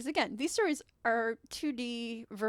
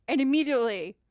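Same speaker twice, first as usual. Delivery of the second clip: normal talking voice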